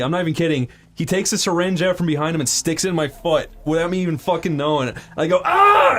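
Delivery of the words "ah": loud, high mock scream; sudden high yell, acting out the jab; retold pain yelp. Patient jabbed by doctor's syringe, mock pain yelp.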